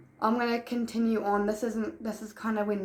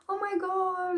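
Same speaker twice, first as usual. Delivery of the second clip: mock amazement; 10 semitones higher, slower than usual. Retold friend's reaction.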